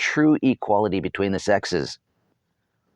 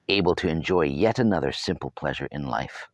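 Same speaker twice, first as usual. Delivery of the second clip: plain reading voice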